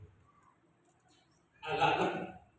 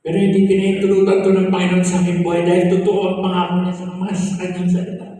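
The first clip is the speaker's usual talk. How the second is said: raised, fervent preaching voice; preaching testimony; 5 dB louder than usual. Acted. Former prisoner telling the church of God's promise, preaching with fervor. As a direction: testify with conviction to the congregation.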